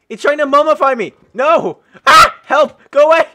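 high, loud panicked yelling at the monster; panicked screaming. Real, not acted. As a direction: shriek in real fright as it leaps at you. Panicked by a fast mutant.

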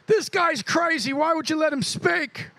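high, indignant mock British accent; Caleb's accent. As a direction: denounce him in a put-on British accent. Imagines Caleb denouncing him in accent.

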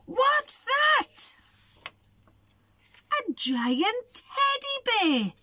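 high-pitched acted astonishment; wide-eyed storybook amazement; startled discovery. A narrator's reading. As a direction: exclaim high, as if spotting something huge. Eddie spots something in the woods.

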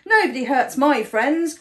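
forceful storybook protector; defiant cry. A narrator's reading. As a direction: cry out to defend friends. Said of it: "cried Safi".

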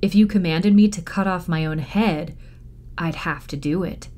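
usual speaking voice

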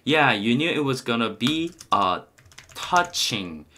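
slow, careful English model sentence; unhurried teacher's demonstration of a movie line; slow demo line. Shown as a demonstration. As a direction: spell it out slowly for learners. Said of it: "만약에 한다면 gonna라고 해주시고요".

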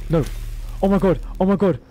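loud high panicked cry; panicked shout. Real, not acted. Gamer running out of oxygen, panicked shout. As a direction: yell in sudden dismay.

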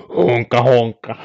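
slow mock honking; imitated walrus honk. Streamer guessing what a walrus sounds like, slow honking. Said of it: "Mitä ääntä valrus pitää?".

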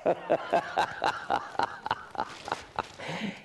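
long run of breathy laughter; mocking laugh. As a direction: laugh at your friend's expense. Amused by friend's nephew trouble.

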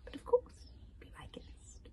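high start dropping to a near-whisper; purring witch. A narrator's reading. Witch inviting the searchers in, purring. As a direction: purr a silky, hushed invitation. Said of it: "purred the witch".

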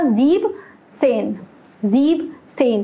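ज़ीब सेन, slow and separated into two words; pronunciation demo. Shown as a demonstration. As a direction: repeat ज़ीब सेन slowly, first word ending on b. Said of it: "तो क्या pronunciation है इसका". (ज़ीब सेन, धीरे और अलग-अलग)

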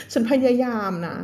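ordinary delivery

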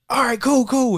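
high, loud and eager; excited kid. Retold or imitated. Kid asked onstage to do the song, imitated as excited. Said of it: "He was excited".

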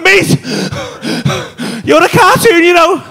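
high, very loud freak-out; alarmed shout. Retold or imitated. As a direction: shout it at your mate in disbelief. Alarmed, telling mate he's a cartoon.

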